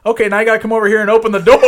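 fast, loud, offhand order; smug leprechaun. Retold or imitated. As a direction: rattle it off like a smug trickster. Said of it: "And he goes".

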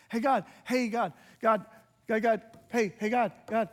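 loud, repeated calling out to God; insistent calling. Retold or imitated. A believer pestering God without pause, calling loudly.

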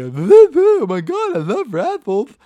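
very high, loud goofy Neanderthal voice; Paul. Retold or imitated. Friend mocking his big wrestler buddy, weird high Neanderthal voice. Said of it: "we'd make this weird voice for my buddy Paul".

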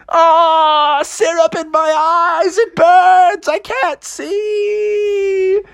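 high wailing cry of pain; hammed-up high-pitched agony; Froggy. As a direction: wail helplessly, stretching the last word. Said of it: "Froggy was like".

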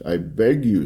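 ordinary delivery

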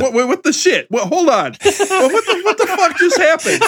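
high-pitched, laughing disbelief; incredulous outburst. Real, not acted. Co-host stunned by Aaron's sudden backtracking, incredulous. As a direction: stop everything in baffled disbelief. Co-host reacts to a surprise verdict.

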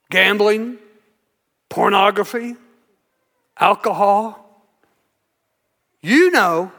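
lightly voiced low, insinuating accuser; accusing Satan. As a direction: needle them with low, knowing accusations, pausing between each. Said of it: "But Satan says".

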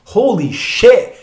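acting out being stunned by what just passed; stunned disbelief. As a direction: draw it out in mock shock. Retold shock after the train horn.

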